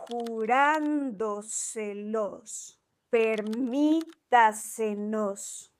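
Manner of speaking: Cayéndoseles, encontrándomelo, jurándoselos, permítasenos, vendiéndoselos very slow, stressed before the last three syllables. Shown as a demonstration. Teacher showing sobresdrújulas, very slow accented Cayéndoseles, encontrándomelo, jurándoselos, permítasenos, vendiéndoselos. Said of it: "stress on the syllable before the antepenultimate".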